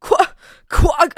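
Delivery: loud laughing quack quack; giggly duck impression. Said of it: "like, a duck".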